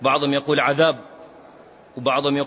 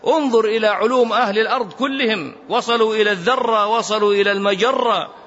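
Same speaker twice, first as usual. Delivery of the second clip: rising fervor; 7 semitones higher than usual. Real, not acted. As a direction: build the point up with passion. Preacher building to a climax.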